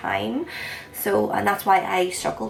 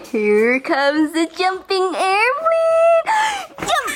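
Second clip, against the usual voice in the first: airplane spoon game; 10 semitones higher, 8 dB louder, much slower than usual. Real, not acted. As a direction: squeal it like zooming a spoon in.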